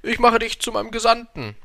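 high, loud royal call; hasty calling after. A narrator's reading. King calls after the departing prince, high and loud. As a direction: call it hurriedly after someone leaving. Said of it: "ihm nachzurufen".

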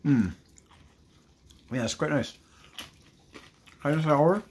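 speaking between bites; chewing while talking. Real, not acted. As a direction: mouth partly full. Seen 0: Bites chip at 2.3, chews while talking around it.

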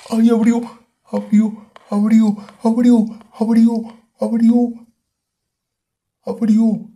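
high panicked shrieking; terrified screaming. Real, not acted. Monster opened the door. (gritando aterrorizado)

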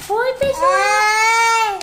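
slow, stretched high hello; drawn-out greeting. Real, not acted. Child greeting the vlog viewers, very slowly. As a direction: stretch out each syllable of the greeting.

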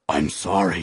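loud, flustered dragon apology; sputtered apology. A narrator's reading. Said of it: "he sputtered".

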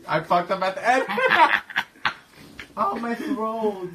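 high, loud burst of real laughter; laughing hard. Real, not acted. After the prank call, laughing loudly and high. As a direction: crack up at your own mistake.

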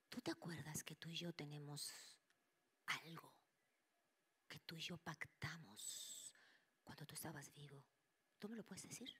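mother whispering a secret test to a spirit. Retold or imitated. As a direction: whisper the test question carefully.

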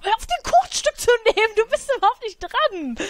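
high outraged scolding at a cake thief; shrill scolding. Real, not acted. Scolding sim for eating cake.

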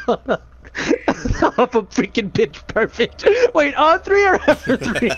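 breathless giggles, words squeezed out; laughing. Real, not acted. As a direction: laugh your way through it. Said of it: "I started laughing before I could even say it".

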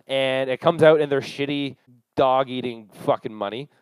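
ordinary talk

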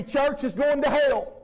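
ordinary delivery

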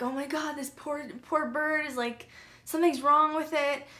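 lightly acted distressed sympathy; mild retold pity. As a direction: voice lifts in retold concern. Voicing her pity for bird.

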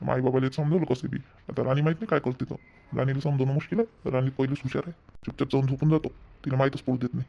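low, soft talking to oneself; private aside. Acted. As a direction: keep the scheming under the breath. Son plots alone, unheard by parents.

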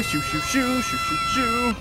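high, light repeated shooing; shooing birds away. Pretend. Player scaring birds out of here, high playful shooing.

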